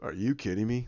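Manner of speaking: low, soft disbelief; Thanos. Retold or imitated. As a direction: mutter it as a baffled villain. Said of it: "you have Thanos go".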